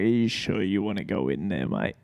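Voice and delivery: low, soft, quick aside in imitation; mock wary check, dropped low and hurried; imitated doorkeeper. Girl at the door questions producer going in, imitated doubtfully.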